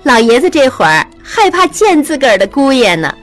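amused calling. A narrator's reading. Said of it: "玉汉笑着在屋内喊曹氏".

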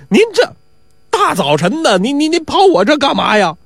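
put-on high, stammering young watchman; flustered questioning. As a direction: stumble over the words in alarm.

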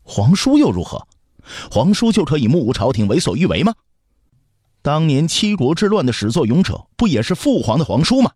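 put-on high-pitched, defiant boy. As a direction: retort indignantly in a high child's voice. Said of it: "刘彻高声道".

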